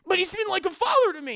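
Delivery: high, loud whine after a laugh; whiny protest. Retold or imitated. Anakin whining to Obi-Wan, high and loud. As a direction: whine it like a hurt teenager.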